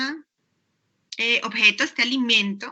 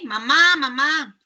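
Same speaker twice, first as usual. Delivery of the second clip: lightly acted call for mom; mild calling out; slower than usual. Child calling for mamá, mild pretend call. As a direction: call "mamá" twice, drawing out the end. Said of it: "La llamé".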